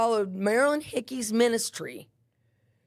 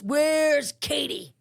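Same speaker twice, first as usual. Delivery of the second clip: imitating a woman calling out, drawn-out and higher; 4 semitones higher, much slower than usual. Woman walking into the unit looking for an inmate, calling out. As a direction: call out slowly for someone across the unit. Woman storms in seeking Katie.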